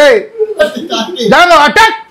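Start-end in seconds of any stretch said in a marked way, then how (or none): 1.3-2.0 high, rising call to the dog; playful battle cry; attack command. Pretend. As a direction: sic the dog on them in play. Mock attack order to the dog.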